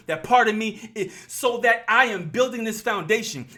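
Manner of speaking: preaching. Real, not acted. Vlogger preaching to his viewers, fired up.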